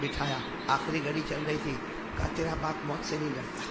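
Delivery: low, soft storytelling voice; hushed dying father. Acted. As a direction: drop low for the father's gentle answer. Dying father calls his daughter close. (دھیمی، پست آواز)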